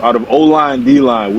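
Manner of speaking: ordinary talk, nothing marked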